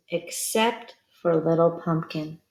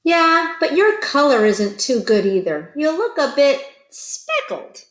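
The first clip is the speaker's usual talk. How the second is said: higher, louder jeering voice; teasing bully pumpkin, lightly voiced; taunting pumpkin; 7 semitones higher, 4 dB louder than usual. A narrator's reading. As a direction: sneer at his color.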